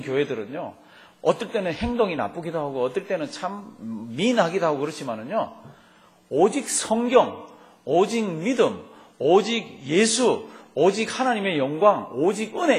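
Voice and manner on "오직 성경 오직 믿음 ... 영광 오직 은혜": 높고 크게, 느리게 또박또박 선포; 한 마디씩 외치듯 느리게 강조. Real, not acted. (성도들에게 오직 구호를 높고 크게 선포)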